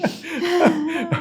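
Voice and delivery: a short, high self-conscious laugh; real sheepish laugh; high embarrassed laugh. Real, not acted. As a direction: laugh at yourself, caught off guard. Laughs after ugly-crying remark.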